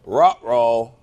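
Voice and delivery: a goofy cartoon-dog oops. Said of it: "you go".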